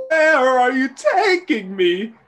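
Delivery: high, slow, tearful plea; frightened girl. Acted. Hildy pleading with her captor, high tearful voice.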